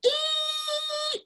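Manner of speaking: very high, sustained whistle shriek; imitated train whistle. Lecturer showing the cut from scream to locomotive, imitated train whistle. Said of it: "the locomotive's whistle is going off".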